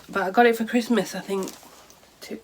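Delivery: usual speaking voice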